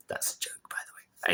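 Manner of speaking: breathy whisper, aside to the viewer. Real, not acted. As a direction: drop to a whisper for the joke. Aside whispered after a joke.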